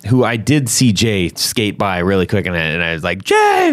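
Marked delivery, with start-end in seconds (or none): 3.2-3.7 high, loud call across the room. Retold or imitated. Spotting Jay skating by, calling his name out loud. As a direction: shout his name to catch him.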